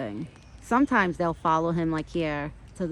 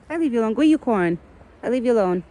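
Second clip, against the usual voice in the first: raised, higher pet-talk call; loud call to horse; 5 semitones higher, 8 dB louder, faster than usual. Real, not acted. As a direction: call out firmly to the big horse. Calling loudly to the horse.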